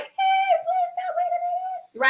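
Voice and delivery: high shout sliding into a breathless hiss; desperate call. Retold or imitated. Begging the cab driver across the street to stop, high shouting. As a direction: yell after the cab, then lose your voice.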